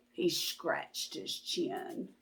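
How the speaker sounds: soft whispered narration. A narrator's reading. Storyteller whispering the detective's pondering. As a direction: whisper it to the audience.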